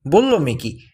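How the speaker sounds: ordinary delivery, nothing marked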